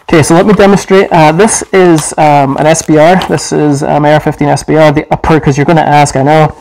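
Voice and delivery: muffled behind mask; through a gas mask. Real, not acted. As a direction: voice inside respirator. Seen 3: Military gas mask worn over face throughout; only helmet removed.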